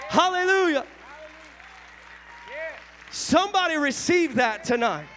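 high, loud preaching at full force; fervent revival preaching, shouted high. Real, not acted. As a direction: lift the church with a rising preacher's cry.

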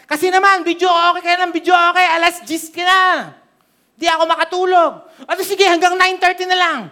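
high, loud, fast mock grumble; complaining neighbor. Neighbor complaining about late-night videoke, high loud mock gripe. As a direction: whine like a neighbor kept awake.

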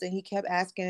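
usual speaking voice

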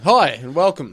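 loud intro. Real, not acted. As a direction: belt the welcome out. Another loud intro take.

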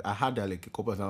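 ordinary talk, nothing marked

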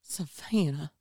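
put-on drawled Southern accent, Savannah style. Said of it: "they use a Southern accent like that".

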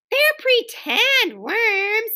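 high, slow exasperated yell. A narrator's reading. Hostess corrects crying guest, yelling. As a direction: yell it high, drawing out each word. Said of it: "yelled Ramona".

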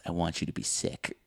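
low, soft movie voice; understated low confession; quiet menace, retold. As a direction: act it hushed and deliberate. Voicing wife's menacing line.

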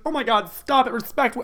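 high-pitched shocked scolding; acted squeaky disapproval; mock scandalized parent. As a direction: play appalled and tell them off. Imagined scandalized parent's reaction.

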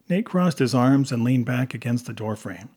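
ordinary delivery

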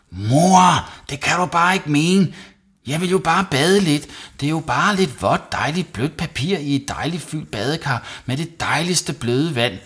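put-on high, whiny child protest; wailing complaint. A narrator's reading. Savi losing his playdates, wailing. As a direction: whine and plead with mum. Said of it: "jamrede Savi".

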